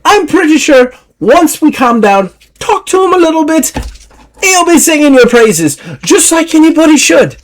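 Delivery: high, breezy Scottish voice; high Scottish woman laughing it off; Scottish lass. Acted. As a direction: brush it off with a cheery Scottish lilt. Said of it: "Deirdre's like".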